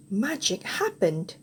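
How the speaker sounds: ordinary delivery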